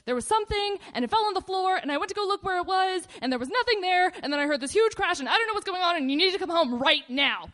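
imitating a frantic phone call, loud and high; screaming panic. Little sister phoning mom home alone, acted screaming panic. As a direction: pour it out high and loud, mock hysterical. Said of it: "she's screaming and crying".

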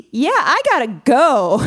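high, loud, drawn-out excuse; high-pitched comic getaway line; awkward excuse to leave. Retold or imitated. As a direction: bail out loudly and slowly. Hurried excuse to escape.

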